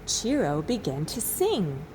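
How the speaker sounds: ordinary delivery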